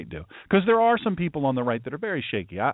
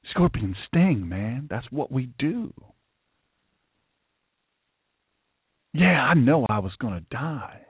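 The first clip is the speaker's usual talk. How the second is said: lightly voiced scorpion, matter-of-fact with long pauses; apologetic scorpion; 3 semitones lower than usual. Scorpion explains its nature to the dying frog, understated resigned apology. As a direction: own up as a scorpion, mildly and unhurriedly. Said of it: "this is what the scorpion says".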